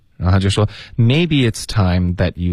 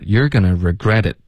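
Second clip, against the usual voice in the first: "you're gonna regret it" as a separate chunk, then a stop; first half. Shown as a demonstration. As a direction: split the sentence, pause after "regret it". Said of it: "你可以分成两段". (分两段说，先说"you're gonna regret it"再停)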